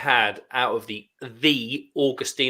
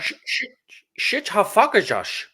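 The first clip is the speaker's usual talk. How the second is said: Dutch accent; 9 semitones higher than usual. Shown as a demonstration. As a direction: fake Dutch gearbox word. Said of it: "In a Dutch accent".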